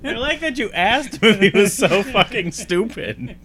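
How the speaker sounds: high and loud, laughing as she speaks. Real, not acted. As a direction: giggle through the whole line. Laughing at earlier insult.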